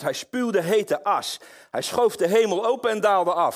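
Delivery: preacher building the storm of God's anger; preaching at full force. Acted. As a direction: thunder it from the pulpit.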